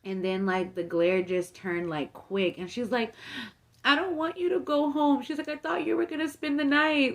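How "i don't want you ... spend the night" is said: high-pitched imitation of an upset cousin; mock tearful protest; retold upset plea. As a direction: plead it higher, mimicking someone near tears. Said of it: "and she starts crying".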